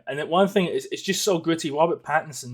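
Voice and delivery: normal talking voice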